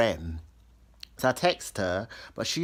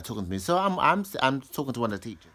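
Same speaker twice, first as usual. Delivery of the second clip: ordinary talk, nothing marked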